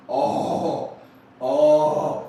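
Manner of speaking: loud rising oh of rapture; ecstatic oh. Acted. Touching the car for the first time, loud ecstatic oh. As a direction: moan with pleasure at the touch. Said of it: "It's like".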